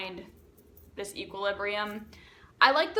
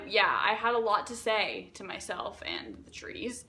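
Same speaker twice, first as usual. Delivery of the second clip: ordinary delivery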